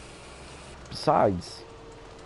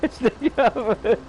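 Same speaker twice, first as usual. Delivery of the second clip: laughing; 12 semitones higher than usual. Retold or imitated. Repeats it through laughter.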